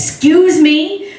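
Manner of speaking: slow, high, loud "Excuse me," with a rising edge; warning excuse me. Acted. Wife warning her husband with a slow, loaded excuse me. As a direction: draw out "Excuse me," as a dare. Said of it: "if she ever says".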